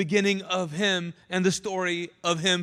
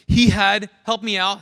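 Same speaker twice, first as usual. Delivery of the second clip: ordinary talk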